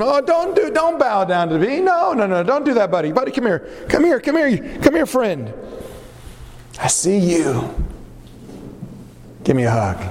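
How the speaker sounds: high, gushing buddy voice; a smooth charmer pulling him in for a hug; fake friendly. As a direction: overdo the warmth to win him. Absalom playing the friendly buddy.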